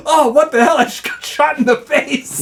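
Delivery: retold yell. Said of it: "and he goes".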